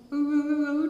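imitated choking. Victim being strangled, acted out as choking noises. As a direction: imitate gasping with the throat squeezed, in short repeated grunts. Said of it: "Rồi ngồi tôi thở có được đâu".

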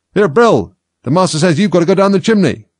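high, loud holler; bossy yell, pitch well up; shouted order. A narrator's reading. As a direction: call the order out to a workmate. Calling orders to Bill.

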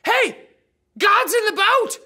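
loud, high excited call; excited shout. Acted. A disciple shouts his discovery to the others. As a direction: yell out the great idea. Disciple realises Jesus is aboard.

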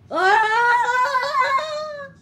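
loud, drawn-out play scream; high, sustained "ah" yell; long scream. Pretend. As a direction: scream it out in pretend alarm. Scream in acted scuffle.

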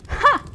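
single high, loud "ha"; scornful laugh. Acted. Demon scoffs at the mortal's wish to leave, scornful laugh. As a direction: burst out one mocking laugh.

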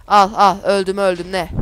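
shocked outburst with a laugh after; startled loud outburst. Real, not acted. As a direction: blurt it out as the game kills you. Sudden death in game.

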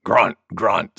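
low boar grunts; grunting boar. Acted. Wild Boar's grunting.

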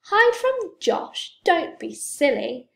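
high, loud, drawn-out disbelief; astonished mum, voice shooting up; incredulous. A narrator's reading. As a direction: scoff at a silly idea. Mum scoffs at her son's alarm.

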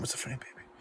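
unvoiced whispered aside. Real, not acted. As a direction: drop to a whisper.

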